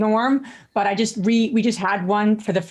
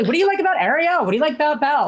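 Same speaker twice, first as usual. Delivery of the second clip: put-on high, bright questioning to a child; playful quizzing of a little girl; dad asking daughter about her favourite princesses, pitched up and playful; 7 semitones higher, faster than usual. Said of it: "Like, literally".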